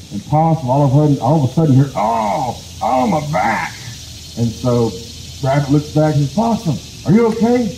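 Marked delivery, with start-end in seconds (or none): 1.9-3.7 pained cry. Acted. Rock lands on Possum. 6.3-7.7 alarmed call. Acted. Rabbit sees Possum crushed.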